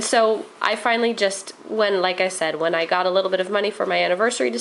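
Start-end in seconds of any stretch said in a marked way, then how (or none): none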